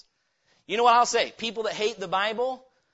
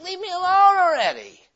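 high, louder whiny brush-off; annoyed brush-off; 11 semitones higher, 7 dB louder than usual. Acted. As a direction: push him away, fed up. Sinner brushing off God's messenger.

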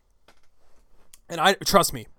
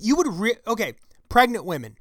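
ordinary delivery

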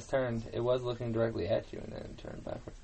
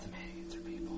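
hushed, voiceless aside; whisper; 13 dB quieter than usual. Real, not acted. Player sharing that the mannequins are people, whispered. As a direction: whisper the creepy discovery. Hushed realization in horror game.

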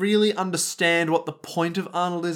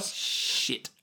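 breathless hushed swear; a scared whispered shit; whispered curse; 9 dB quieter than usual. Acted. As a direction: whisper it in dread. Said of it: "Rohan and Koichi are like".

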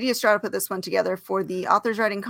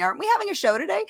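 high mock Jane voice; 10 semitones higher, faster than usual. Mimics Jane's message in story.

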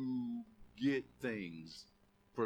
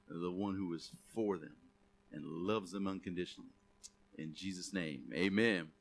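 low, hushed prayer to close; 6 semitones lower than usual. Real, not acted.

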